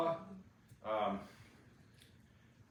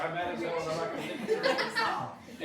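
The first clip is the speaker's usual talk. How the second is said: mock fed-up rant; 7 semitones higher, 4 dB louder than usual. Quoting a fed-up man, mock furious rant. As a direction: ham up being fed up. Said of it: "you're just like".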